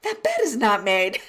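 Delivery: laughing playful disbelief; mock outrage. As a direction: tease him with fake shock. Grandma mock outrage at messy bed.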